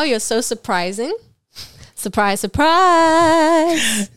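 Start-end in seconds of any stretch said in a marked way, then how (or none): 1.9-4.1 slow, drawn-out sing-song "surprise"; sing-song teasing. Pretend. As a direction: tease in a stretched, loud sing-song. Teasing catchphrase about a meme.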